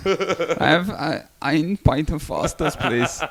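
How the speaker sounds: deliberate mock German accent with "ein". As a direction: order slowly as a German, saying "ein" like "ine". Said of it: "you can do a German accent".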